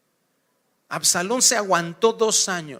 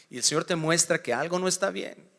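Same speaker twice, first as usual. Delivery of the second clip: ordinary delivery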